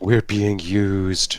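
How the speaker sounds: slow, drawn-out realization; mock dismay. Friend realizing he is being exploited, slow mock dismay. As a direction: stretch it out in mock shock.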